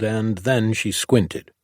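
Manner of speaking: plain reading voice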